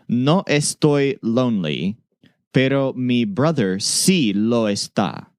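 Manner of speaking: slow and deliberate, each word laid out; slow, careful model sentence. Shown as a demonstration. Teacher modelling the key sentence slowly for students. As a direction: spell the sentence out slowly for learners. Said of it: "Remember to emphasize learning just this sentence".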